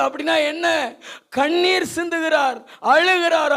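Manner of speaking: ordinary delivery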